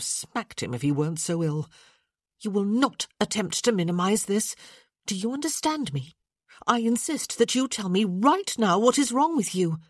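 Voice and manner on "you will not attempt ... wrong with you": insistent. Acted. Insisting angrily.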